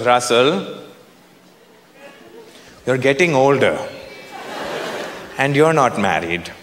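low, measured mom voice with long pauses; mock-grave nagging mother; Indian mother. Mom urges her son to marry, low measured nagging. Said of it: "she goes".

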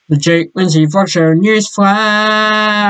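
slow, drawn-out show title call; announcer voice. Pretend. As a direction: announce the show name like a jingle.

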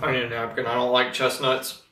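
talking while chewing; mouth partly full. Real, not acted. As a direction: food held in mouth. Seen 0: Bit chestnut at -5, grimaces with food likely still in mouth.

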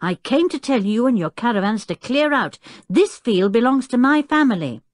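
understated angry half-shout; almost shouting. A narrator's reading. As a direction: order them out crossly. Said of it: "almost shouted Tinker".

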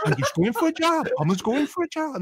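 put-on high, lilting botched Geordie accent; failed Geordie, sounds Indian. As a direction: attempt Geordie but drift towards Indian, amused. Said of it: "No, it sounds Indian".

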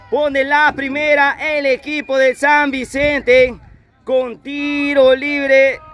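high, slow, still buzzing after the goal; echoing stadium announcer. Real, not acted. Tras el gol. (narración eufórica, aguda y lenta)

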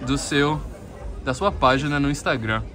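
ordinary talk, nothing marked

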